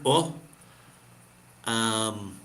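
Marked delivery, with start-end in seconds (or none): 0.0-0.3 "O" pushed out with a puff of air. Shown as a demonstration. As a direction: push the air through "O". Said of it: "push the air".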